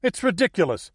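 high-pitched Los Angeles woman's protest; mild shout. A narrator's reading. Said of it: "shouted the blonde woman from Los Angeles".

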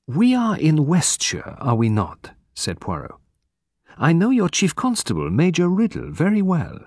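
high, precise foreign detective; lightly voiced fussy Belgian. A narrator's reading.

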